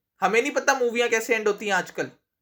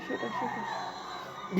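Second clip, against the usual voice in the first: scared whisper; 2 semitones higher, 13 dB quieter, much slower than usual. Real, not acted. Scared by the gory scene.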